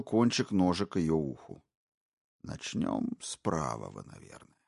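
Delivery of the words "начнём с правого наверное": low, musing, almost casual menace; thoughtfully. Acted. Intruder calmly musing over the knife, low and thoughtful. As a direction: weigh the choice like a calm butcher. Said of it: "задумчиво произнёс он".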